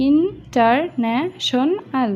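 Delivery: International slowly in five beats, IN, TAR, NA, SHON, AL; separated syllables. Shown as a demonstration. Teacher separating international to count five syllables, slowly. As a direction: say international with every syllable set apart. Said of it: "যদি এটা আলাদা করেও দেই".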